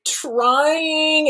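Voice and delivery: high, drawn-out mock whine; hammed-up complaint, stretched and high; whining Luke. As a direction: whine it slowly in protest, pitched high. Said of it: "and he's like".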